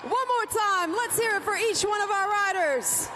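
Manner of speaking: fast, rising crowd rally; rally speech. Real, not acted. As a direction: whip the crowd into noise.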